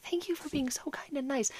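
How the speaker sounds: a hint of crying while thanking; tearful thanks. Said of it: "I'm still bawling".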